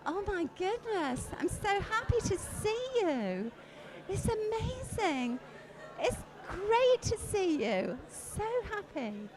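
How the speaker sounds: high, loud, delighted hello to a friend; beaming excited welcome; overjoyed greeting. Shown as a demonstration. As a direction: gush with joy at seeing someone. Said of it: "do exactly the same thing again".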